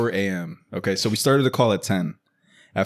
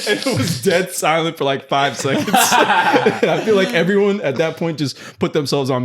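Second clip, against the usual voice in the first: laughing; 7 semitones higher, 4 dB louder than usual. Real, not acted. Still laughing at the story.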